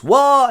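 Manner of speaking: loud high-pitched stage roar; mock lion roar. The lion roars.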